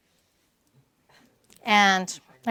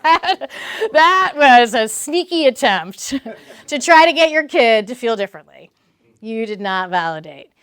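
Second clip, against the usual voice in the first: teasing call-out; playful scolding; 3 semitones higher, 8 dB louder than usual. Retold or imitated. Therapist calling out parents, playful scolding. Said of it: "and I'm like".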